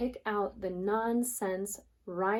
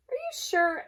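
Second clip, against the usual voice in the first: much higher, questioning voice; a skeptical friend; doubting duck; 10 semitones higher, 4 dB louder than usual. Acted. Ducky doubts the hen.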